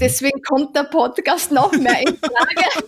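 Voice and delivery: amused laughing delivery. Real, not acted. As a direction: let the laugh carry the whole line. Laughing punchline about the podcast.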